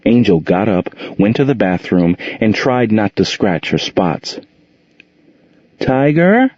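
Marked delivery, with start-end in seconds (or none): 5.8-6.5 much higher questioning call; little girl calling for her missing cat. A narrator's reading. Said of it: "she called".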